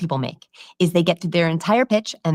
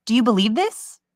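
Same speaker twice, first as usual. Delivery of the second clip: THIS lifted high as a question; high, rising plea on "this"; asking for belief; 6 semitones higher than usual. Acted. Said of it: "Liars are asking".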